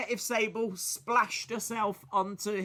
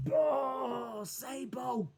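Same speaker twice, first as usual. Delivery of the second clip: normal talking voice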